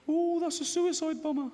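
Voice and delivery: high, panicky inner voice blurting it out; a squeaky scared voice in his head; fearful inner voice. Retold or imitated. Comedian voicing the scared side of his mind, high and panicky. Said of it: "this one goes".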